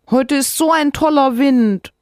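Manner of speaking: put-on childlike voice. Narrator voices child Sophia.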